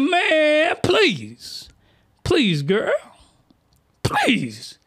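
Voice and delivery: high, drawn-out exclamation; exasperated character, high voice; Beast Mode protest. Acted. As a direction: pitch it way up in protest.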